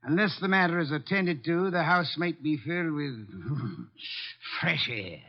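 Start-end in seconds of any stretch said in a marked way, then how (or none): 3.2-4.5 a low, amused little laugh mid-sentence; soft sly chuckle; dry chuckle. Acted. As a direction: chuckle at your own wit. Chuckles at his own joke.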